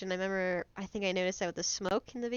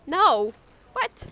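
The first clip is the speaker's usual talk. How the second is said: shocked no; 7 semitones higher, 7 dB louder than usual. Real, not acted. Shocked at friend's joke.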